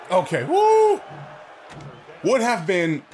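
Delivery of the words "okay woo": high loud whoop; celebrating a play; woo cheer. Real, not acted. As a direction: cheer the lucky break.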